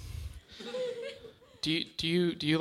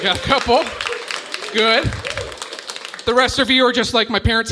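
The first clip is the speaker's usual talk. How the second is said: loud crowd jab; 7 semitones higher, 9 dB louder than usual. Real, not acted. Comic jab at quiet crowd.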